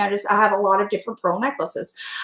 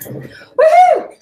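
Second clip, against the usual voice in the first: loud, high woohoo cheer; excited whoop; 7 dB louder than usual. Real, not acted. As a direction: let out a joyful whoop. Cheering while cooking.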